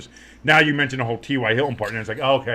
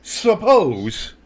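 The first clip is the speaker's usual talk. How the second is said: slow high broadcast voice; mock sideline reporter; 7 semitones higher, 5 dB louder, slower than usual. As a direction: report the injury like TV.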